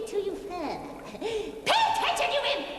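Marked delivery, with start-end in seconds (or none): 1.2-2.7 witch's command. Acted. Witch barks at apprentice.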